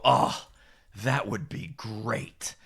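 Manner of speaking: slow, softening mock sigh of longing; imitated dreamy relief. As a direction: imitate clients melting at the thought. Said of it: "They look with this misty-eyed glaze".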